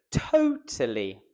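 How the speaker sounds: big TO, then drop on "tally"; stress on TO. Shown as a demonstration. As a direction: hit the first syllable, TO-tally. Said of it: "is to try them both".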